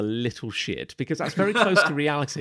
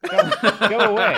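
high, loud laughing shout; shooing shout; 10 semitones higher, 8 dB louder than usual. Real, not acted. Co-host shooing the cat from the bowl, laughing shout. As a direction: yell it away through laughter.

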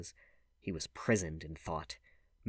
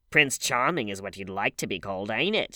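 loud taunting call; raised voice, climbing at the end; yelling after him; 9 dB louder than usual. A narrator's reading. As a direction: jeer loudly at someone walking away. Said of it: "she yelled after him".

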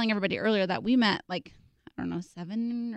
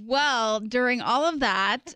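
ordinary talk

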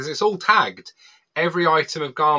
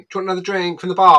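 fast, loud, higher mock friend offering a drink; mock tipsy friend; 7 semitones higher, 9 dB louder, much faster than usual. Guest mimicking a rubber friend offering drinks, loud and quick. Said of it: "And I'm there going".

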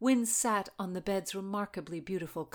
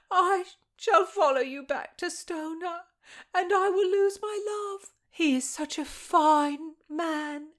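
flowery lovelorn maid; 13 semitones higher, 7 dB louder than usual. Acted. Maid confesses her love to her mistress, flowery and tearful. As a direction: pour out the romance dramatically. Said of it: "And the flowery tone".

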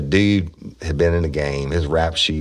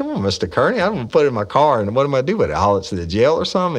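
exasperated retort; 11 semitones higher, 4 dB louder, faster than usual. Retold or imitated. His own retorts to the boss.

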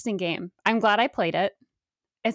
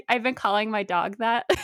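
ordinary talk, nothing marked

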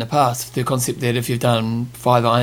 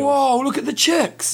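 surprised; 10 semitones higher, slower than usual. Real, not acted. Surprised at small field.

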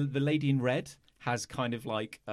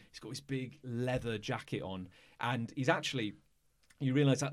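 ordinary delivery

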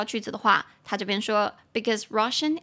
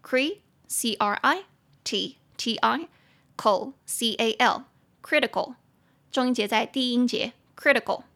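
spelled out slowly; slower than usual. Shown as a demonstration. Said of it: "三个音节".

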